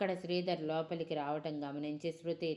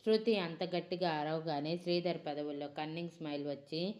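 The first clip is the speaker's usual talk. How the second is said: ordinary delivery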